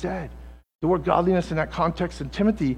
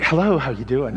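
nice phone voice; 3 semitones lower, 3 dB louder than usual. Retold or imitated. Wife answering the phone, sweet phone voice. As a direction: switch to your polite phone manner. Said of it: "Your wives have phone voice".

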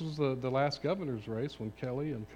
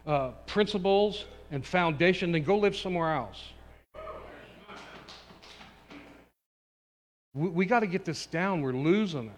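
loud, driving sermon voice; rally the congregation with force; fiery preaching; 4 semitones higher, 7 dB louder than usual. Acted.

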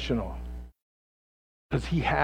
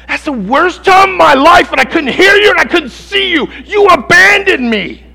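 high, loud accusing cry; wounded disciple crying out; anguished shout; 14 semitones higher, 18 dB louder than usual. Acted. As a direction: hurl it at Jesus, hurt. Voicing Peter's complaint to Jesus.

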